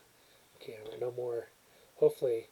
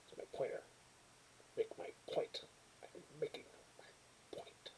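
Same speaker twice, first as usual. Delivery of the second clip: soft unvoiced whisper; breathy secret whisper; 13 dB quieter than usual. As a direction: hush it as a playful aside. Playing with pointer, whispering.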